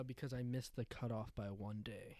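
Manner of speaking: hushed whisper. Real, not acted. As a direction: whisper it low. Whispering his answer.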